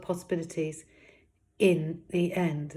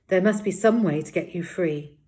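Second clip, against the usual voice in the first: louder character voice, set on a solution; Paz, determined; 3 semitones higher, 9 dB louder than usual. Acted. Paz to her trapped brother, determined. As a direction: say it as Paz, refusing to give up. Paz spoken line vs narration.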